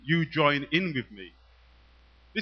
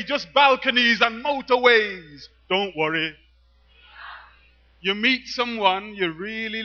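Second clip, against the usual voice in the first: louder, higher performance poetry over music; poem performance; 7 semitones higher, 8 dB louder than usual. Acted. Poet performing to the audience, loud and rhythmic. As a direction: deliver the poem with punch and rhythm.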